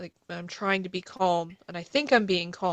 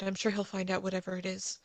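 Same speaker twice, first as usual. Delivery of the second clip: ordinary talk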